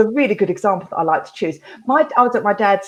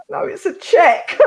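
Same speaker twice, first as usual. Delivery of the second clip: stunned dad; 10 semitones higher, slower than usual. Retold or imitated.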